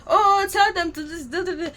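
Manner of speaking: mock-whiny imitation of online critics. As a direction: parody the nagging critics. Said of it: "Some people are like".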